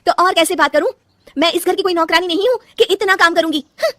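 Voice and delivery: high, loud defiant outburst; daughter-in-law yelling at her mother-in-law; furious retort. Acted. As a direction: shout that you are no servant. Daughter-in-law shouts back.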